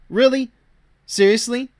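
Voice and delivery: high, disbelieving questions; incredulous. Real, not acted. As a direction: pitch each word up in scornful disbelief. Scoffing at the false teacher.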